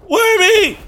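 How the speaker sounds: high, shrieking cry of alarm; loud scream. Acted. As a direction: scream for the lost friend. Puppet removed, weasel cries out.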